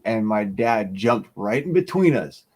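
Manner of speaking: normal talking voice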